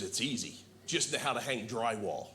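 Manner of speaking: lightly voiced buddy. Buddy voiced, dry advice.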